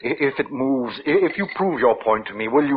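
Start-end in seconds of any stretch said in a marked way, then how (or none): none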